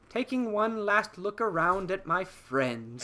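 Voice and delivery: a hint of a prim robot taking a last look; wistful C-3PO. Podcaster voicing C-3PO looking round at his friends, slow and wistful. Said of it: "three P O is just like".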